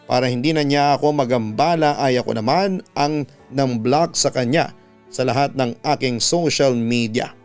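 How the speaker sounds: ordinary delivery, nothing marked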